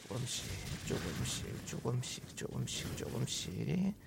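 fading into a whisper. Shown as a demonstration. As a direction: breathe the words out in a whisper.

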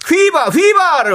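high loud chant of huiba; shouting. Shown as a demonstration. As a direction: shout it out like a cheer. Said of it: "외쳐주시면".

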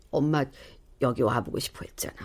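soft breathy whisper to her absent mother; whispered longing. Acted. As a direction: whisper it as if she can't hear. (그리움 섞인 속삭임)

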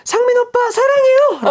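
high, loud, swooning actress confession; gushing love confession. Retold or imitated. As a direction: squeal it like a lovestruck girl. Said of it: "하면서 고백하면서 뽀뽀하면서".

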